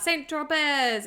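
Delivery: drawn-out playful whoop; mock yell. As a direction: call it out like a cheer. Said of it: "he sort of yells out".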